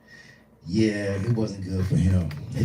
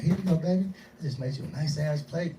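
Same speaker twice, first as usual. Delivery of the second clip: mother voice; 5 semitones higher, 9 dB quieter than usual. Retold or imitated.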